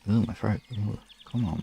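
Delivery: normal talking voice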